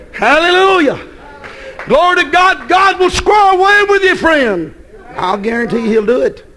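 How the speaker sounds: rejoicing preacher at full voice; joyful preaching. Acted. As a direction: lift the church up with praise.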